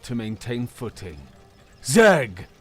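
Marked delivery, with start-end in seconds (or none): 1.8-2.5 loud battle call. Acted. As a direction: shout his name across the fight. Grucker calls across the battle.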